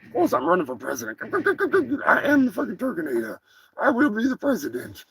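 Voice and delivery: a cocky cartoon turkey boasting; turkey character.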